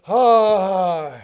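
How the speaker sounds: a long, loud groan of release; loud sigh of relief. Acted. The desperate king finally relieving himself on the shore, groaning loudly. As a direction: let it all go with a big ah.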